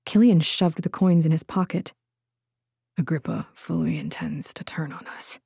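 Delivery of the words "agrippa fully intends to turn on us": whispering. A narrator's reading. Secret talk about a traitor.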